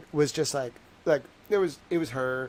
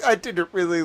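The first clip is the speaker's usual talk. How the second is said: ordinary delivery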